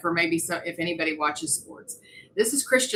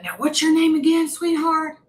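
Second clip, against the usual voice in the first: a higher, louder, lightly voiced kindly old man; mild old man voice; 9 semitones higher, 4 dB louder than usual. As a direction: play the sweet old man asking her name, only a touch. Old dancer asking her name.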